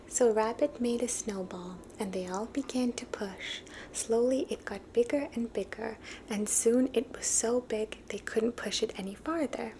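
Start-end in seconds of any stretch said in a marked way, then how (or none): none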